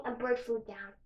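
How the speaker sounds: usual speaking voice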